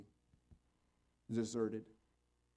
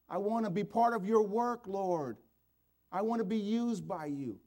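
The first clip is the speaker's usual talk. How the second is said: impassioned plea; 11 semitones higher, 10 dB louder than usual. Acted. Preacher voices a repentant commitment.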